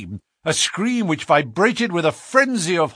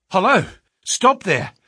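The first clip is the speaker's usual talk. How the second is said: loud, high shout to stop them; 6 semitones higher, 4 dB louder than usual. A narrator's reading. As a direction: call out to halt the cart. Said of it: "he shouted".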